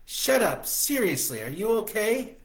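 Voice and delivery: high, loud worried woman; worried Stella. A narrator's reading. Stella worried about his sickness, high and loud.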